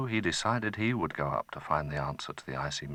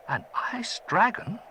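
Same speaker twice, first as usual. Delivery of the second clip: trembling voice; 13 semitones higher, 4 dB louder than usual. Acted. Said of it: "in a trembling voice".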